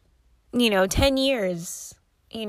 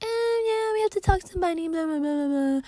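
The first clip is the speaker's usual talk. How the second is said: lightly mocking, higher put-on voice; mock dismissive blah blah voice; mocking brush-off; 7 semitones higher than usual. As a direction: parody the managers stringing her along.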